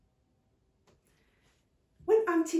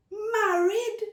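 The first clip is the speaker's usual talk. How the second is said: high, loud incredulous "Married?"; shocked disbelief, almost laughing; astonished aunt; 14 semitones higher, 9 dB louder than usual. Acted.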